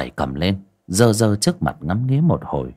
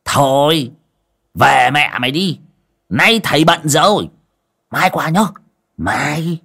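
shooing him away; 6 semitones higher than usual. Acted. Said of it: "ông Đồng lại đuổi".

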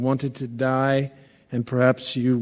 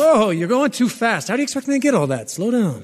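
flustered protest; 8 semitones higher, faster than usual. Acted. Guard can't keep up with dictation.

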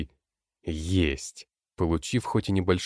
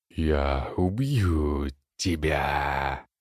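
growling howl; 2 semitones lower, much slower than usual. Acted. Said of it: "То ли рычанием, то ли хрипением, то ли воем".

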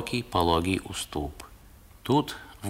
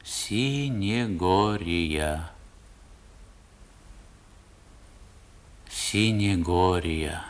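slow, drawn-out, dreamy calling; slow, echoing repetition of a name; slow incantation; 3 dB quieter, much slower than usual. A narrator's reading. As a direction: let the name hang in the air. Place name repeated with pauses.

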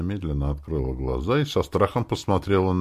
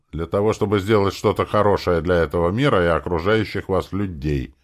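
ordinary delivery, nothing marked

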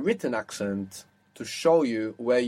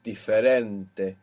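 diferente, slowly, with the weight on REN; stress on second-last syllable; 2 semitones lower, slower than usual. Shown as a demonstration. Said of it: "the second last syllable".

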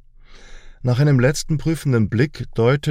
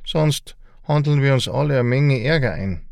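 grumbling; 4 semitones higher than usual. A narrator's reading. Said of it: "brummte er".

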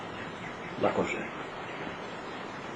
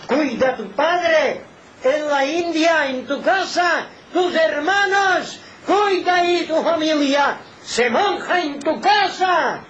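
high, slow, drawn-out oratorical cry; high-pitched pulpit exhortation; impassioned preaching; 11 semitones higher, slower than usual. Real, not acted. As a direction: preach it at full force, stretching each phrase. Preacher at full force.

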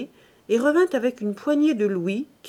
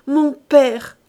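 loud, higher outcry; ringing cry; 4 semitones higher, 9 dB louder than usual. A narrator's reading. Daughter crying to father so loudly the maid comes, ringing. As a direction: cry it out to be heard. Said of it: "cria Eugénie d'une voix si éclatante".

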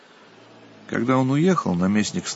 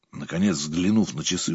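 ordinary delivery, nothing marked

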